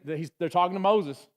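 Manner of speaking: ordinary talk, nothing marked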